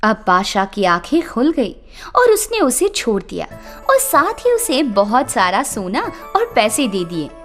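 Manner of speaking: plain reading voice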